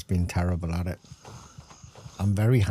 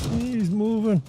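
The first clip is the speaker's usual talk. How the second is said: excited; 5 dB louder, slower than usual. Real, not acted. Alarmed at enemy moving.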